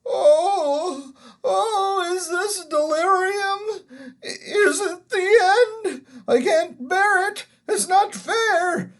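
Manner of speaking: put-on high, loud, tearful old man's lament; wailing. A narrator's reading. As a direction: whine and wail in self-pity. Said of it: "it was wailing on".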